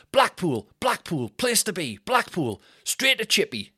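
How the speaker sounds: high, punchy stand-up catchphrases; Dino Bean. Retold or imitated. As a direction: rattle off a hopeful bid to win the crowd. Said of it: "tries to get the crowd. He's going".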